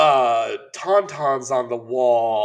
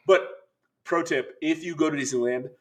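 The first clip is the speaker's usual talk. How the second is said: ordinary talk, nothing marked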